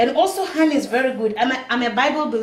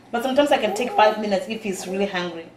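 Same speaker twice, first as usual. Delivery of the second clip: usual speaking voice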